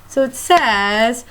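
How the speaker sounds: normal talking voice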